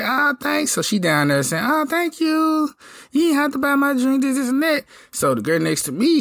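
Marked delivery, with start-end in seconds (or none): 0.0-0.7 high mock girly voice; mimicking the woman. Voicing the woman at the bar. 1.6-4.8 high mock girly voice; mimicking the woman. Voicing the woman at the bar.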